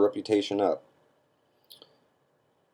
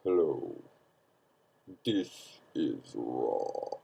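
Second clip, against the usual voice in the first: very low, drawn-out mock channeler; deep channeling voice; 8 semitones lower, 10 dB quieter, much slower than usual. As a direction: put on a deep, slow, portentous trance voice. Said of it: "with his voice all deep".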